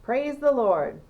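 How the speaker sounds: loud, high and slow; drawn out like a jubilant cry to heaven; slow joyful yell. A narrator's reading. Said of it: "yelled Grandpa Joe".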